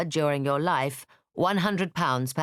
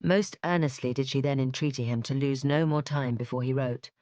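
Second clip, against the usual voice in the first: ordinary delivery, nothing marked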